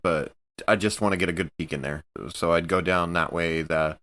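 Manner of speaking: normal talking voice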